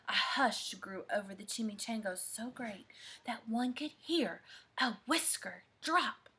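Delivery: very soft hushed narration. A narrator's reading. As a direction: drop to a hush. Hush described in story.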